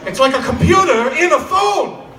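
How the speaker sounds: hammed-up Doc Brown proclaiming. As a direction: declaim like a wild-eyed inventor. Improv Doc Brown scene.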